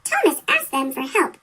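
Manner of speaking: plain reading voice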